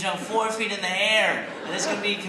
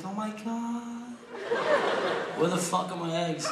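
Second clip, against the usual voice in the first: mock concern; 6 semitones higher, 3 dB quieter than usual. Rude customer to crying waitress.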